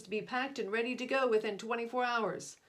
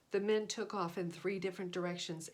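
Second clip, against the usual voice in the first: usual speaking voice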